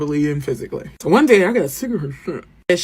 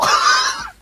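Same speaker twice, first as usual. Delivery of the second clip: high screaming laugh; shrieking laugh. Real, not acted. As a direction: burst out in a shrill shriek of laughter. Laughing at own confession.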